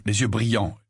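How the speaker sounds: plain reading voice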